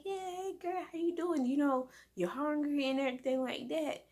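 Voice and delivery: high sing-song voice for the cat; bright high hey girl to a pet; pet talk. Retold or imitated. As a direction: coo at the kitty like a baby. Greeting the cat at lunchtime.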